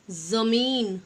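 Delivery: ज़मीन with a buzzing z, not j; ज़मीन said zameen with z. Shown as a demonstration. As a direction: start ज़मीन on a z sound. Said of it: "जैसे ज".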